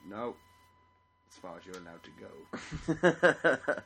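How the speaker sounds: game voice. Retold or imitated. Game voice at hospital doors.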